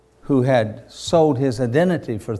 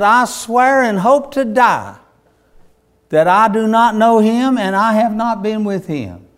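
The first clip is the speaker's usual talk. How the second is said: frantic oath; 8 semitones higher, 6 dB louder than usual. Acted. Peter swears the oath again.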